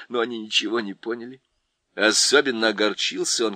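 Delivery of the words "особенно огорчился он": ordinary delivery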